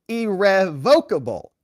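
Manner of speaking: irrevocable slow and high, ee-rev-VOKE-uh-bul; muddled pronunciation. Retold or imitated. Witness attempting the disputed word, irrevocable slowly said ee-rev-VOKE-able. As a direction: draw out irrevocable, landing hard on VOKE. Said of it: "hard to tell which syllable".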